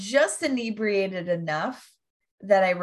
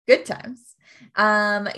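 ordinary talk, nothing marked

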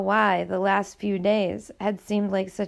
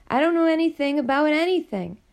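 higher, pleading voice; 7 semitones higher, 3 dB louder, faster than usual. Acted. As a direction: beg them to understand. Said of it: "she pleaded".

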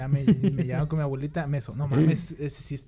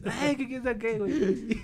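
very high, slow teasing squeal; mocking brothers; 7 semitones higher, slower than usual. A storyteller imitating brothers teasing him on the ground, in a high slow squeal.

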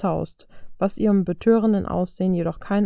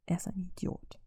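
soft, subdued apology; apologetic; 11 dB quieter than usual. A narrator's reading. Violet apologizes to Steve about Tony, softly. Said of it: "blickte ihn entschuldigend an".

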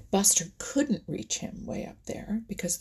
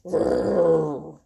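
low rumbling growl; deep animal growl; bear growl; 3 semitones lower, 6 dB louder than usual. A narrator's reading. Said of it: "growled Buster Bear".

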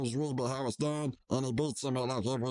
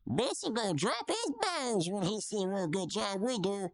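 mock Rigby; 7 semitones higher than usual. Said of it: "Rigby says".

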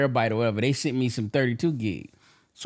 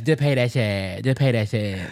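low imitating wife; 5 semitones lower than usual. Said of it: "And here comes Jazz".

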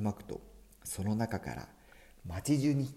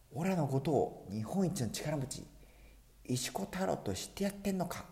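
angry; 7 semitones higher than usual. Acted. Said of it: "男は怒ったのなんの".

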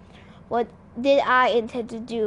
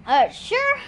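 high, startled little reply; nervous squeak; 11 semitones higher than usual. A narrator's reading.

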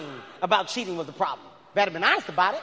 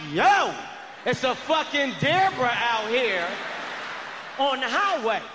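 raised, urgent mock yell; alarmed calling out; 2 semitones higher, slower than usual. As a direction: call out in hammed-up alarm. Acted alarmed phone call to zoo.

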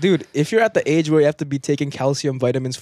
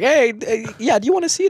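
high mock old person voice; 9 semitones higher than usual. Imitating old person offering.

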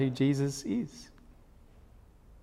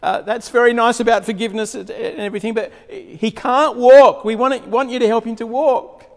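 higher and louder, protesting from above; calling down from the roof; 8 semitones higher, 7 dB louder than usual. Retold or imitated. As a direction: shout down, politely frantic. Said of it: "They're calling down and saying".

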